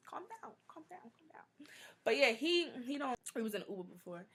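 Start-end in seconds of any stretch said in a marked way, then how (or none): 0.1-1.7 hushed unvoiced whisper; soothing whisper, calm down. Soothing fiance in public, whispering.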